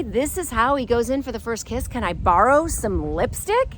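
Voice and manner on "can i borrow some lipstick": full-voiced mocking quote, rising question; incredulous re-quote. Woman mocks man's first kiss line, incredulous quote. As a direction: repeat his line in open disbelief. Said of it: "this is how he approaches me".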